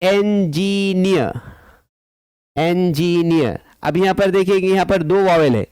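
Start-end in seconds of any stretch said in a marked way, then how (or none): 0.0-1.4 "engineer" very slowly in three beats, en-gi-neer; drawn-out syllables. Shown as a demonstration. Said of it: "Some syllables have three syllable also". 2.6-3.6 "engineer" slowly measured as en-gi-neer; drawn-out syllables. Shown as a demonstration. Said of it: "Some syllables have three syllable also".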